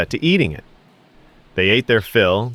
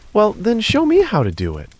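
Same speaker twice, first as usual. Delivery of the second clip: put-on innocent boy; 11 semitones higher than usual. Boy tricks her.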